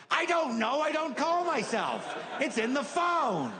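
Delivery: put-on high, slow shouting old man; old man shouting in frustration; exasperated old man. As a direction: yell it like a helpless old man. Old man insists on the phone.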